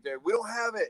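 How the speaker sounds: high, exasperated imitation of a crewman; retold irritation; mad camera operator. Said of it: "And he's all mad".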